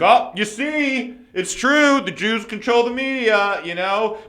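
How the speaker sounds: high, slow mocking sneer; mocking bigot voice. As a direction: mimic a smug conspiracy nut. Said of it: "people wanna be so quick to be like".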